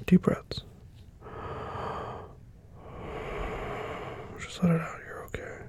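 soft, whispered breathing coach; calming whisper. Acted. Calming partner.

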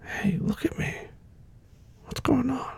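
whispered, gentle concern; tender whisper. Acted. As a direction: coax her to look up, softly. Comforting crying girlfriend.

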